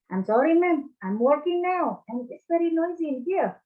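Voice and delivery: casual American phone apology; American accent. Shown as a demonstration. Teacher demonstrating an American accent to the class, lightly done. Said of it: "Itu Amerika".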